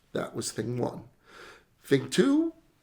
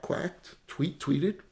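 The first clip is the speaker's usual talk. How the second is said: normal talking voice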